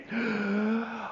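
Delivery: high, soft strained intake; held-breath gasp. Acted. Pupil obeying the no-breathing rule, gasping. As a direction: suck air in quietly and hold. Class holding its breath, replayed.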